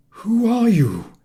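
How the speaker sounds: high, loud, slow bewildered call; bewildered old man calling out. A narrator's reading. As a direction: call out into the empty room, startled. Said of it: "he called out, bewildered".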